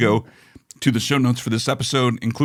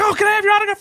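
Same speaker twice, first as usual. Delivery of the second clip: much higher, eager fan begging for an autograph; starstruck fan; 4 dB louder, faster than usual. Retold or imitated. Contestant asking Tony Randall for an autograph, high eager plea. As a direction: gush and beg like a fan. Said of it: "He's like".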